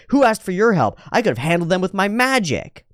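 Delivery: pissed-off retort. Retold or imitated. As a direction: bark it like a spoiled kid who got saved. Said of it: "He's pissed".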